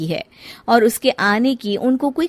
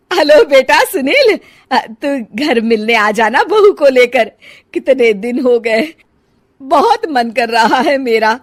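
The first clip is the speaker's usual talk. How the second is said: pleading mother; 5 semitones higher, 7 dB louder than usual. Acted.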